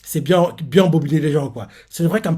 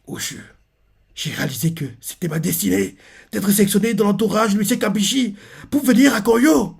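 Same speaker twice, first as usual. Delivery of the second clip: flirt; 8 semitones higher than usual. Retold or imitated. Reader mocks the suitor wooing Ochu, hammed-up flirt. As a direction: build from hushed name to overblown declaration. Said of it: "Le gars fait le dragueur".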